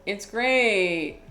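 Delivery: forced enthusiasm that lands as sarcasm; unintentionally sarcastic. Retold or imitated. Student practicing enthusiastic intonation for his teacher, sounding sarcastic. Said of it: "it came across as extremely sarcastic".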